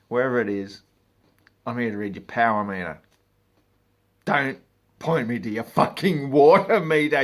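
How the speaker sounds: loud, fast exasperated tirade; fed-up rant. Pretend. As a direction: spit it at the customer. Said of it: "I just wanna say".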